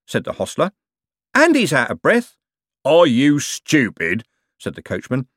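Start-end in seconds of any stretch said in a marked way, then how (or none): 1.3-2.4 high, loud accusing point; insistent, wary hostler; suspicious. A narrator's reading. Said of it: "said in a suspicious voice". 2.8-4.2 loud, very slow incredulous question; heavy, drawn-out exasperation; exasperated. A narrator's reading. Coachman berates suspicious hostler.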